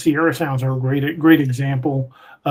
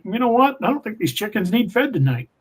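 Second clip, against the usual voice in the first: higher-pitched mock second thoughts; imagined chicken owner backing off; 7 semitones higher than usual. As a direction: back out of it in a raised, comic voice. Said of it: "I'd been like".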